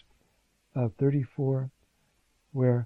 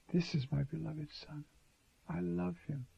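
much softer, intimate and loving; tender Father; 11 dB quieter than usual. Retold or imitated. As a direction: say it gently, like a father's love.

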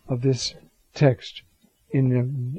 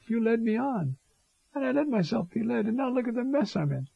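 higher and softer, a plaintive prophet pleading; Jeremiah's complaint; 8 semitones higher, 5 dB quieter than usual. Retold or imitated. Jeremiah quietly complaining to God who misled him, plaintive. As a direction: ache it out quietly, like a hurt friend. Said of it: "No other prophet talks like this".

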